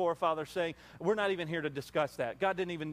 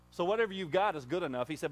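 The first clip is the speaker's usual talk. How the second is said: ordinary talk, nothing marked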